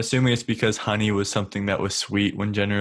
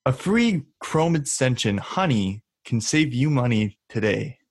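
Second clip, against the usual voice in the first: ad read; 3 semitones higher than usual. Acted. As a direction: sell it like a radio ad. Reading the sponsor ad.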